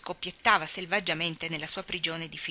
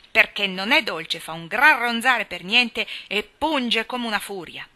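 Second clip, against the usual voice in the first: spiteful jab; 2 semitones higher, 6 dB louder, slower than usual. A narrator's reading. Tommy's spiteful riddle answer.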